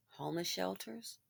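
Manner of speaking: hushed, voiceless suggestion; whispered question. Real, not acted. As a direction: float the grim option in a whisper. Whispered grim answer to own question.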